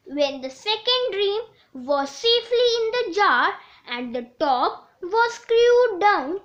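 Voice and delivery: ordinary delivery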